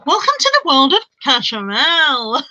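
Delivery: slow, grand comic perfume pitch; Victoria Wood. Retold or imitated. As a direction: draw out a mock advert line. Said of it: "took me back to Victoria Wood going".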